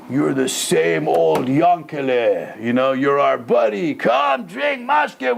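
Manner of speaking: slow, drawn-out buddy coaxing; drunk Russian soldiers. Retold or imitated. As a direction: egg on an old drinking pal. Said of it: "he's like Russian drunk soldiers".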